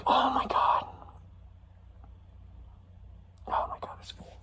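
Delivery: whispered dismay, fading away; aghast, all breath and no voice; mortified whisper. Retold or imitated. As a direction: whisper it to yourself in disbelief. Mortified inner reaction to friend.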